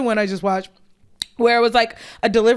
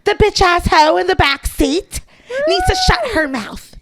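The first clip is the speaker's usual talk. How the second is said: higher, slow mock Karen rant; higher demon-Karen mock yell; demonic Karen; 8 semitones higher, 4 dB louder than usual. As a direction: ham up a raging Karen, climbing high. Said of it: "She looks like a fucking demon".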